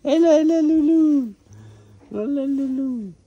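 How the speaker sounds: high cooing puppy talk; talking to a dog. Real, not acted.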